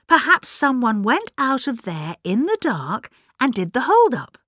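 high-pitched, excitable musing aloud; chattering, puzzled woman. A narrator's reading. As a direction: think aloud in a high, eager voice, then get muddled. Figure puzzles aloud over the hold-up.

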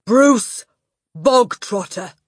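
loud, high bark of a name. Acted. Headmistress barking a boy's name at assembly. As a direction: bark the name across the hall. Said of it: "the Trunchbull barked".

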